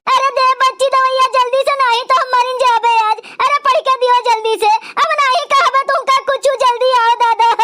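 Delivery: urgent pleading with a sick groan; pleading in pain. Acted. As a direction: beg the girl for the medicine. Aunt begs the girl, in pain.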